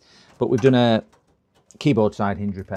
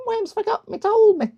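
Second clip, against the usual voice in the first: Worms impression; 4 dB louder than usual. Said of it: "how he sounds".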